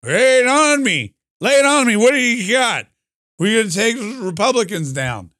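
put-on high, strained drunk old man; Joe Biden. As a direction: play an old drunk president, slurring it out. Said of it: "That's my Joe Biden impression. He's drunk and old".

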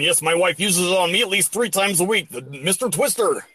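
a hint of a smooth sports announcer; host the product segment like a pro commentator; legit commentator. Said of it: "You sound like a legit commentator".

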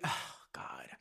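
whispered oh God through tears; sighing, barely voiced, holding back crying; real teary whisper; tearful sigh. Real, not acted. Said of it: "Why am I crying?".

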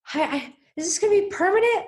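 high fast panicky squeak; panicked. Retold or imitated. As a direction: replay the freak-out, high and rushed. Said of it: "I was freaking out earlier".

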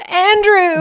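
high, loud mock yell; retold yell. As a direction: call the name out as if chasing someone.

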